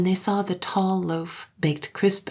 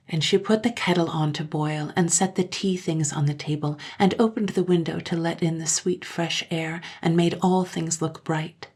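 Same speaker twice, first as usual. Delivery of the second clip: usual speaking voice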